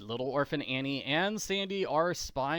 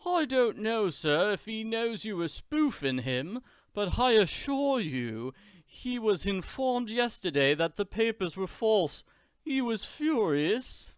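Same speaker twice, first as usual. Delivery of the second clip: higher, prim butler voice; a nervous servant reporting, pitched up; Norman voice; 8 semitones higher than usual. Acted.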